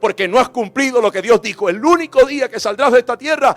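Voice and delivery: impassioned sermon building to loud; full-force preaching. Acted. Preacher vowing service to God before congregation, impassioned preaching. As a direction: drive the promise home to a loud climax.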